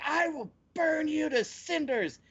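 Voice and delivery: high, slow hammed-up threat; pissed off. Imitating insulted noble with a ball of flame, hammed-up anger. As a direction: stretch out a mock-furious villain threat. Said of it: "pissed him off even more".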